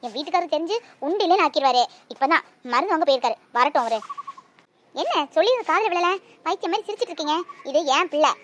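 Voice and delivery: loud, indignant rebuke; mother angrily telling the stranger off; angry mother. Acted. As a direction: defend her baby, loud and firm.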